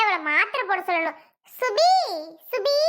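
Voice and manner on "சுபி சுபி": calling out. Acted. Mistress calling her maid Subi from the next room, loud. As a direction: call the name loud up the house.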